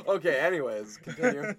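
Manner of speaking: loud, high, still laughing. Real, not acted. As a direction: push on through laughter.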